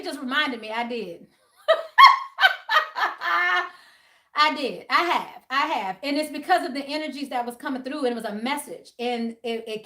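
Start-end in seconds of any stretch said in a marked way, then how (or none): 1.7-3.7 loud high real laugh; burst of laughter. Real, not acted. Laughs at own admission.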